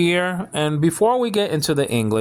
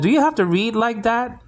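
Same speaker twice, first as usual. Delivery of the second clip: quoted complaint; 8 semitones higher than usual. Retold or imitated. Acts out critic's complaint.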